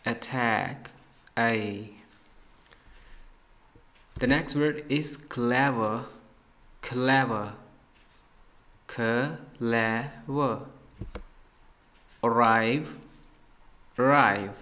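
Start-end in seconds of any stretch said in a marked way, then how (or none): 4.2-10.6 slow teacher announcement; slow announcing. Shown as a demonstration. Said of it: "announces new word". 12.3-14.5 arrive louder, weight on rive; stress on rive. Shown as a demonstration. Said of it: "ए के ऊपर ज़ोर मत दें".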